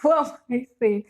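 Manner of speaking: laughing at herself mid-question; high, loud laughing question. Real, not acted. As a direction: giggle through the words, amused at your own slip. Laughing at her own slip.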